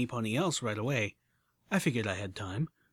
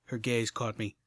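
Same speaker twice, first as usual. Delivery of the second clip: ordinary delivery, nothing marked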